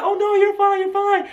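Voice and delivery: high-pitched cooing, acted out; fussing reassurance. Bystanders comforting crying [name], high fussing reassurance. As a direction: hurry to comfort the star, voice way up.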